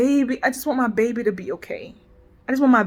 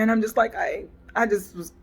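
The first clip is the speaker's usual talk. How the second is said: ordinary talk, nothing marked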